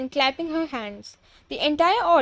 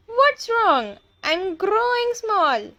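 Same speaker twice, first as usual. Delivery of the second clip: high, drawn-out cry of alarm; 8 semitones higher, slower than usual. A narrator's reading. Tall boy finds himself shrinking, crying out high. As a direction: pitch it up and stretch the end. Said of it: "cries out".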